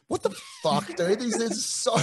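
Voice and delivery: high-pitched mock shock at the size of them; a teammate imitating his own awestruck gasp at the French forwards; stunned disbelief. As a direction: squeak it out like you can't believe your eyes. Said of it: "and I was like".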